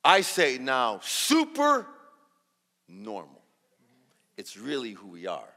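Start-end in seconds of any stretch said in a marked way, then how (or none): 1.0-1.8 "super" pitched way up and loud; stress on super. Shown as a demonstration. Speaker builds up the phrase, emphatic high "super". 2.9-3.4 "normal" dropped low and soft; understated normal. Shown as a demonstration. Speaker lands the punchline, low understated "normal". Drop after high super.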